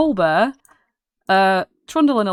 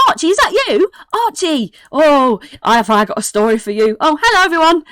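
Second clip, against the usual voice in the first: high, loud, overjoyed greeting; excitable kid; 9 semitones higher, 5 dB louder than usual. Acted. Excitable kid greets Archie after spotting her, thrilled. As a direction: burst out delighted to spot an old friend. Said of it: "like, excitable".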